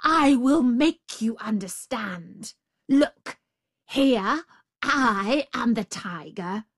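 enraged tiger. Acted. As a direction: spell it out in a rage. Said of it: "in a rage at the jackal's stupidity".

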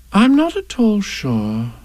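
slow, high, pondering; thoughtful. Acted. Kanga considering a cold bath, thoughtful. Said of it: "in a thoughtful voice".

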